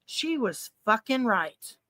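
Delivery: slow, soft, weighted words; hushed, drawn-out realization; stunned realization. Retold or imitated. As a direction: let it sink in, word by word. Recalls realizing mom was right.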